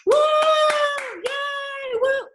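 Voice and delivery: high, cheering woo and yay; imitating cheering crowd. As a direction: mimic the crowd cheering from afar. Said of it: "a bunch of people going like this".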